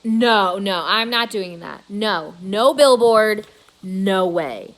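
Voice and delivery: loud, slow emphatic no; exasperated dad refusing; firm refusal. A narrator's reading. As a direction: shut the idea down hard. George refusing firmly.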